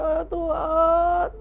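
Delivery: high, slow, hammed-up weeping plea; acted sobbing prayer; tearful prayer. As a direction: beg God while crying. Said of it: "Nangis dia".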